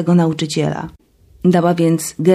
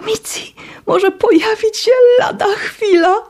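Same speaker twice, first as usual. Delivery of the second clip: squeaky, much higher voice; beaming squeal. Acted. Zuta squealing happily to the roommates, much higher. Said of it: "pisnęła rozpromieniona".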